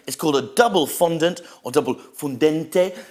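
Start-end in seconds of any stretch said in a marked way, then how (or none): 1.7-3.0 mock Italian accent. Shown as a demonstration. Reviewer naming the Italian snack, mock Italian accent. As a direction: double fondente with a hammed-up Italian accent, fun-DEN-teh. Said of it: "with some kind of Italian accent".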